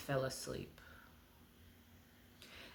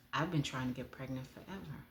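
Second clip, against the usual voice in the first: ordinary talk, nothing marked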